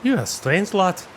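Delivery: put-on high gruff-sounding old dwarf woman. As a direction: speak up high as the weathered dwarf. GM voices the dwarf.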